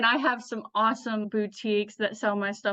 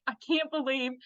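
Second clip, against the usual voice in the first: ordinary talk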